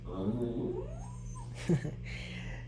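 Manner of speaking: high, soft witchy cackle; high breathy witch laugh. A narrator's reading. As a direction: let out a wicked little cackle. Witch gasps in story.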